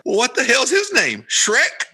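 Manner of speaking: high-pitched exasperated punchline; mock incredulity. Man asking about the oldest son, mock incredulous.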